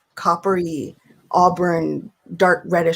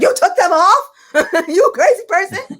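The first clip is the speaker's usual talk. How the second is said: much higher and louder, laughing through it; amazed laugh at mom; teasing mom with a laugh; laughing disbelief; 11 semitones higher, 5 dB louder than usual. Retold or imitated. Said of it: "I'm like".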